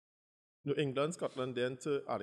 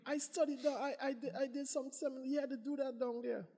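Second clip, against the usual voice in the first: condescending doctor. Retold or imitated. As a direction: lecture him from above.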